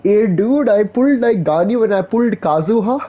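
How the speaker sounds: a light Indian gamer brag; Indian accent. Pretend. Mock gamer telling a friend about his pulls, light Indian accent. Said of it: "every Indian can do the Indian accent".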